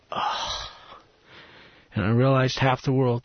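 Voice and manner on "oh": soft, voiceless exhaled "oh"; breathy sigh. Retold or imitated. Preacher reliving the moment he realized, soft breathy sigh. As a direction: let out a quiet breath of realization. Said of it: "in that moment that I went".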